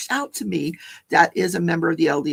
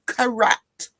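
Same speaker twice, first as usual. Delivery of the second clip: mock snide approval, a bit raised; imitated snide comment; 5 semitones higher than usual. Lori commenting on a witness's answer, mildly snide. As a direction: mimic a smug courtroom aside. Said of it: "she'll make a snide comment".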